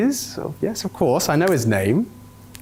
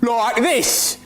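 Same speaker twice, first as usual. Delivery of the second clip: high, hammed-up Aussie imitation; exaggerated mock Australian twang; mocking Australian accent; 10 semitones higher than usual. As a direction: mimic an Australian accent, pitched way up. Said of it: "always going to sound like that".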